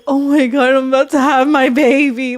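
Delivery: hammed-up happy father; mock teary husband. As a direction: gush like a movie husband. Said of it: "he's like".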